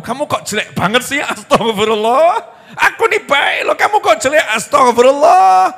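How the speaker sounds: high, loud mock tirade of a wife; scolding istighfar. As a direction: berate him, then wail a long pious exclamation. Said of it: "Itu istighfar modus namanya itu". (omelan istri yang dibuat-buat, melengking dan keras)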